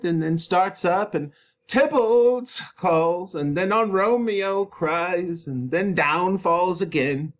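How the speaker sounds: high, loud nurse, drawn-out lines; grieving nurse. Acted. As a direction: dwell on each fall and cry. Nurse describing Juliet's grief.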